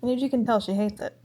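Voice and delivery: ordinary delivery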